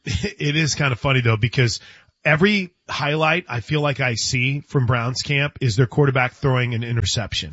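normal talking voice

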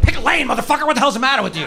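high, loud mock road rage scream; road rage yell. Comic yelling at a driver in traffic, high and loud. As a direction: yell at the other driver, laughing. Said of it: "It's like".

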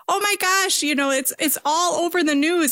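high-pitched worked-up mom voice; imitated excited mom. Mom telling her kid about the news, imitated high excitement. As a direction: mimic an agitated mother, pitched well up. Mother's excited reply on phone, retold.

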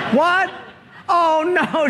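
high, startled "What?" and "Oh, no"; sudden dismay at the mistake; shocked realisation. Retold or imitated. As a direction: jump up high in alarm. Caller realises wrong number.

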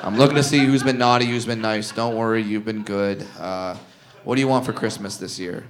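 low, soft, defeated playing along; resigned mock elf. Comedian to the kid, quiet resigned elf. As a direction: swallow your pride and murmur. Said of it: "I go".

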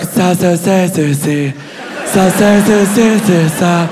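hushed, sibilant elf-speak syllables; mock Elvish murmuring; elf princess. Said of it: "She was like".

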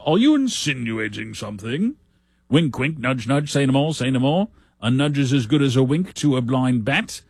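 lightly British Monty Python voice; a hint of a sketch-show Brit leering; Monty Python impression. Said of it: "as, uh, Monty Python would say".

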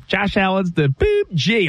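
drawn-out, happily mimicked child; too-happy little kid. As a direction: imitate a kid gleefully repeating dad. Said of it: "he said it too happily".

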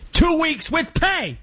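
high, drawn-out impression of a movie tough guy; Alec Baldwin's character. Host quoting The Departed, high movie-character impression. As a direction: do the film character's outburst, high-pitched. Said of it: "sounding like Alec Baldwin's character".

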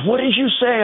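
high pitched outraged question; mock indignant protester. Speaker imitates protester confronting him, high and indignant. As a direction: mimic her affronted challenge. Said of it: "She goes".

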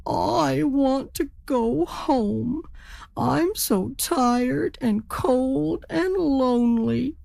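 high, slow acted wail; tearful turkey. A narrator's reading. Turkey tells the raven he's homesick, tearful. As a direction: whimper it out, words stretched. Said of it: "started to cry".

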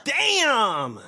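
loud, high, drawn-out exclamation; impressed shock. Real, not acted. Co-host reacts to the toxic brand jab, loud impressed shock. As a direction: holler it as the jab lands.

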